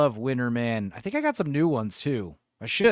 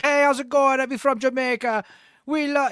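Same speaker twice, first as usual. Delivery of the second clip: put-on higher, lilting Jamaican accent that drifts Irish; 10 semitones higher, 3 dB louder than usual. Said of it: "he sounded like a leprechaun".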